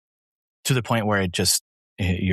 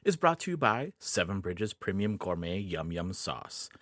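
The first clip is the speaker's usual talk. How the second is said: chipper 1950s ad; 7 dB quieter than usual. Acted. Sponsor ad read.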